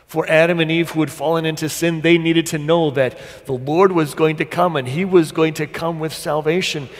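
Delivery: loud, high, driving pulpit proclamation; full-force preaching. Real, not acted. Preacher proclaims God's promise to Adam and Eve, full-force preaching.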